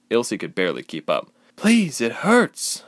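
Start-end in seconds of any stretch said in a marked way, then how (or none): 1.6-2.8 high, slow plea of pain; begging. A narrator's reading. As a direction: beg through the hurt. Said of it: "she begged".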